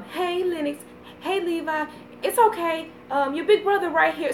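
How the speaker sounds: lightly voiced nervous boy, high and soft; imitating big brother. Big brother calms his crying baby brothers softly. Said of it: "you know, goes".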